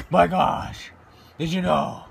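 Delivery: usual speaking voice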